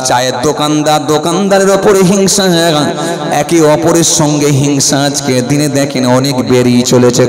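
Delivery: loud, full-force sermon voice; fiery preaching. Acted. Preacher warning the crowd about envy, full force. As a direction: thunder it at the congregation.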